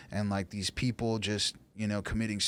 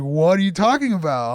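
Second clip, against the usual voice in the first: confused friend; 8 semitones higher, 11 dB louder than usual. Retold or imitated. Friend's confused reply, retold.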